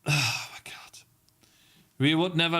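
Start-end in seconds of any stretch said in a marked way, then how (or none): 0.1-1.0 soft breathy oh my God; barely voiced gasp of disbelief; whispered disbelief. Real, not acted. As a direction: whisper it, stunned. Reacting in disbelief to chat.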